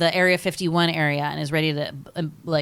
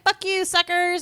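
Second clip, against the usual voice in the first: high mock taunt; 12 semitones higher, slower than usual. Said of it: "Randy Quaid goes and he's like".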